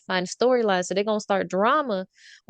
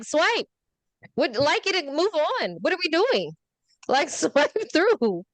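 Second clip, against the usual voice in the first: impatient; 8 semitones higher than usual. Retold or imitated. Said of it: "I'm like".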